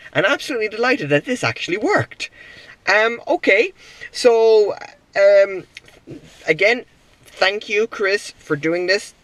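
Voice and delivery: high, excited and thrilled; delighted. Real, not acted. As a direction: burst out with real delight. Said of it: "I am thoroughly, thoroughly impressed".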